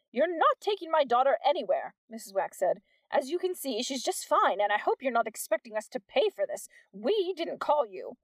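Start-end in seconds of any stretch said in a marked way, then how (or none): 0.1-1.9 higher and louder, protective indignation; indignant mother. A narrator's reading. Mother refuses paramedics. 3.1-8.2 haughty mother. A narrator's reading. Mother defends, refuses to pay.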